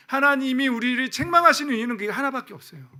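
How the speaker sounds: loud, high, impassioned preaching that slows at the end; full-force preaching. Acted. Pastor to congregation on the covenant, fiery preaching. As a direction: drive the covenant home at full volume.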